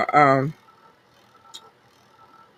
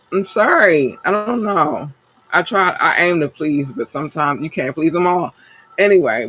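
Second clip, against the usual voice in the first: ordinary delivery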